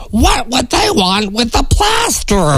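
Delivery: puzzled disbelief as the duck; amazed duck. Acted. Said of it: "The duck shakes his head in amazement and says".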